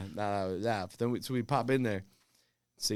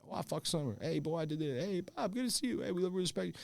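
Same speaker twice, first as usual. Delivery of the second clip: higher, teasing heckler voices; mocking fans; 6 semitones higher, 9 dB quieter than usual. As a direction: chirp it like a passing heckler. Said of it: "chirp you".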